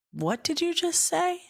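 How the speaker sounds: high-pitched stunned question; retold surprise. Passenger questions the Uber driver's greeting, surprised. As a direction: imitate being taken aback, pitch jumping high. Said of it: "and I was so surprised".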